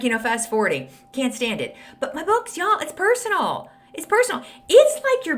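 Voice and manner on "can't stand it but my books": ordinary talk, nothing marked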